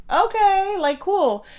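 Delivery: drawn-out, very high okay; high-pitched agreement. Retold or imitated. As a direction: imitate a squeaky, surprised acceptance. Awkwardly delighted reply retold.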